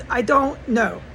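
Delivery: normal talking voice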